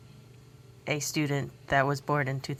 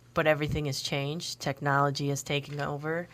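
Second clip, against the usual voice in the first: ordinary talk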